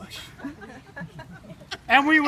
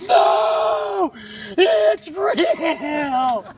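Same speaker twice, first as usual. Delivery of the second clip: frightened insistence; 3 semitones higher, much slower than usual. Retold or imitated. Cole insists to Dad, crying in fright. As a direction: plead it, scared and near sobbing. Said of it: "And Cole ran up and said".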